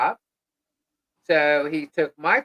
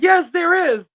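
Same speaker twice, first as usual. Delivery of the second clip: insisting friend; 11 semitones higher, 9 dB louder than usual. Retold or imitated. Robbie insisting, voiced high.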